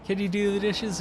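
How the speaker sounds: light put-on partner voice; lightly voiced wife. Acts wife's request in bit.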